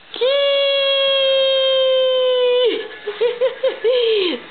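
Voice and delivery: high bursting giggle; real laugh. Real, not acted. Mother laughing at her baby, high bursting giggle.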